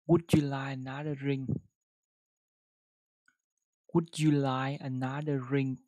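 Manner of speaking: careful model sentence. Shown as a demonstration. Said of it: "đọc một câu với nó".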